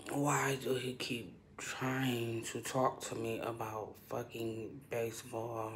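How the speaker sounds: slow hushed whisper, hiding. Real, not acted. Hiding in the bathroom from her boss, whispering. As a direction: keep it down so the boss can't hear.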